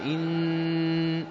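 إن drawn out slowly, nun hummed; slow ghunna demo on إن; held nasal. Shown as a demonstration. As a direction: hum the nun long. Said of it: "مقدار حركتين".

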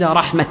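رحمة cut off on a final t; stop on t. Shown as a demonstration. Teacher posing the question of stopping, رحمة ending in t. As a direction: end رحمة as rahmat, no vowel after it. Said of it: "إذا وقفت عليها بماذا تقف؟".

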